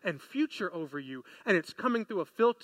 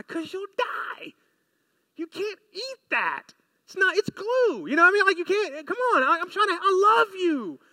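pleading parent; 9 semitones higher, 4 dB louder than usual. Acted. Pleading parent voicing warning to child.

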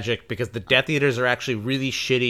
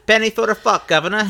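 mock cockney; 6 semitones higher, 6 dB louder than usual. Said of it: "Eliza Doolittle".

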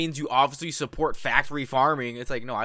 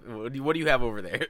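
ordinary talk, nothing marked

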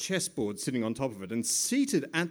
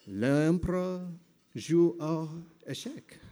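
put-on French accent; 3 semitones higher, slower than usual. Mock French narration.

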